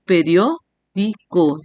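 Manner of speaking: "periódico" slowly, broken as pe-rió-di-co; split into syllables. Shown as a demonstration. Teacher showing the diphthong stays together, syllable by syllable. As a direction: say "periódico" in separate beats, rió kept as one. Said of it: "cuatro sílabas".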